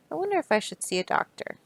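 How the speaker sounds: rushed mock-concern; quick joking aside. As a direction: toss it off as a silly worry.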